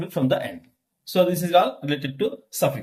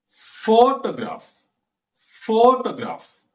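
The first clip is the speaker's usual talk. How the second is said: "Photograph" slow, PHO high and long; stress on first syllable; 5 semitones higher, slower than usual. Shown as a demonstration. Teacher demonstrating for listening class, strong first-syllable stress. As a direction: hit PHO in "Photograph", then drop. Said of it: "Stress in first syllable".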